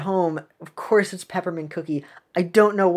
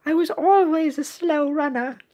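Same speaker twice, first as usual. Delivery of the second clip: timid little cookie; 9 semitones higher than usual. Acted. Small cookie translating the shark's words, high timid voice.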